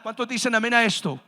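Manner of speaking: loud preacher's call to the church. Real, not acted. Preacher repeats call for amen louder.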